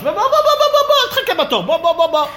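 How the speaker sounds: חיקוי קריאה גבוהה וחזקה; לזרז אותו לעקוף את התור, בקול רם; קריאה נמרצת מחוקה. Retold or imitated. אדם קורא לקרובו לעקוף תור. (קריאה גבוהה ודוחקת, בהגזמה)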